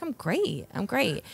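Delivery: high-pitched, fake-okay reassurance; feigned fine. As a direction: act out pretending you're great after crying. Claiming to be fine after crying.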